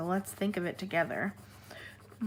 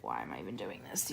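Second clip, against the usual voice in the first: whispered thought; 9 dB quieter, faster than usual. Retold or imitated. Inner thought while coloring light colors.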